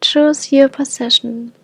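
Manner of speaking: unhurried meditation voice with long pauses; guided meditation. Acted. Teacher leading listeners to invite hope into the body, guided meditation. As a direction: guide them gently inward, phrase by phrase.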